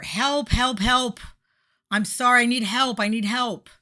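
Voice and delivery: high, loud call for help from the water; hammed-up high yell for help. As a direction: cry out high and loud like someone drowning. Drowning person calling for help.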